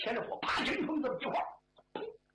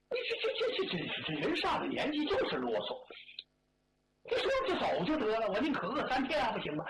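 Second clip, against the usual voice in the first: annoyed; 6 semitones higher than usual. Acted. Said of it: "刘世昌烦了".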